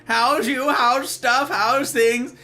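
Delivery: high, loud welcome; exuberant greeting. Real, not acted. Streamer greeting Silver in chat, exuberant. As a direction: sing out a big hello.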